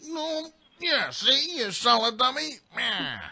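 high, strained gangster voice while being held back. Retold or imitated. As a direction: squeal it while straining against someone. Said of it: "like someone's holding you back".

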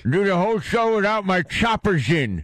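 put-on doddering old man, no teeth; toothless old man. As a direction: play a gummy old geezer. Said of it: "You old doddering fool".

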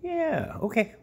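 very high cooing voice; squeaky praising a dog. Real, not acted. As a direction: coo up high at him.

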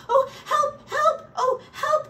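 very high, loud squealing cries; panicked cry for help. A narrator's reading. Mouse, trapped with the badger outside, crying for help. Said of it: "cried Mouse".

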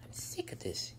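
whispered under her breath; fed-up wife whispering; exasperated whisper. Acted. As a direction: hiss it to herself.

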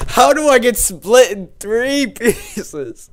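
high, loud, drawn-out yell; slow incredulous shouting, stretching the words; outraged disbelief. Real, not acted. Gamer whose fighter got split in pieces, outraged yell.

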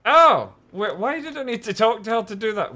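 high, loud, incredulous outburst; loud astonished realisation. Real, not acted. Player learning the cord could be thrown, loud disbelief. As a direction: exclaim in disbelief at the obvious answer. Realises puzzle solution, indignant.

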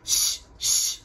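whispered shh; shushing. A narrator's reading. Dolls hushing each other while Raggedy thinks, whispered. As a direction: hush the others softly. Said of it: "they said to each other ... quietly".

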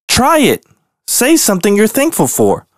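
very high, excited coaxing; eager bear. Acted. Bear urging his gloomy family to try. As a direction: urge the family on.

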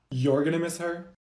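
ordinary talk

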